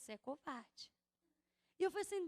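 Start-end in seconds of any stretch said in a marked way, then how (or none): 0.0-0.9 lower, quieter accusing voice; a deep, hushed rebuke; God's voice. Retold or imitated. God rebuking the pastor, lower and quieter. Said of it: "Aí Deus falou".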